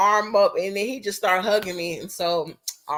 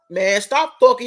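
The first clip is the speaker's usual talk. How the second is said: louder imitated yell of "stop fucking"; mock angry boyfriend; 4 semitones higher, 7 dB louder than usual. Boyfriend snapping at her over the coworker, mock loud. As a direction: bark it at her like an annoyed boyfriend.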